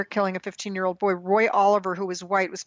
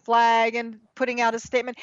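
ordinary talk

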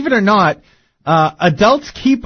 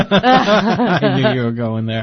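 laughing; 2 semitones lower, slower than usual. Real, not acted. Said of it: "laughing reply".

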